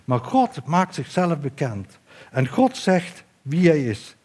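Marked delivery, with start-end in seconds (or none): none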